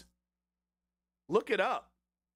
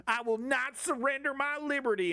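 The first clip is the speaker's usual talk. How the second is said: mock slogan voice; 8 semitones higher than usual. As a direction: ham up the defiant slogan, pitched up. Mocking slogan.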